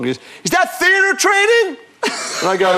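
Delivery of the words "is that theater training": high, loud mock yell from the director; loud, high mock director's yell; imitating a shouting director. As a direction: bark it across the set like an angry director. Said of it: "he's a shouter".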